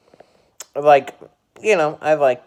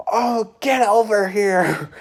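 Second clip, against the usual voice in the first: coach calling out; 9 semitones higher, 5 dB louder, slower than usual. Retold or imitated. Coach summons the culprit.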